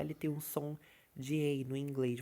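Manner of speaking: ordinary delivery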